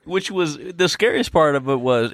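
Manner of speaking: ordinary talk, nothing marked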